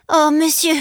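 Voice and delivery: put-on high, pleading boy's cry; desperate child appeal. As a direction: cry out to the gentleman, pitched well up. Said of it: "m'écriai-je".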